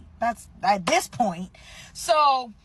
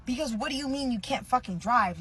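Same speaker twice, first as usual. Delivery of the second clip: ordinary delivery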